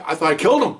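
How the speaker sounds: ordinary talk, nothing marked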